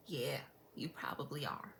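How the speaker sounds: whispered accusation; low, very soft verdict under the breath; muttered suspicion. Acted. Eyeing neighbors as lipstick thieves, whispering her suspicion.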